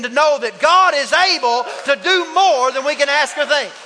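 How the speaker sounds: loud rising sermon, driving the point home; preaching at full force. Acted. Preacher rallying the congregation, loud and fervent. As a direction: push it out to the back rows.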